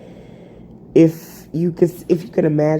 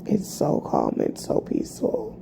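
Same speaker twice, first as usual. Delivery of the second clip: soft breathy whisper, much softer; 6 semitones higher, 7 dB quieter than usual. Shown as a demonstration. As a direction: hush it like a secret. Repeats calm phrase, then whispers.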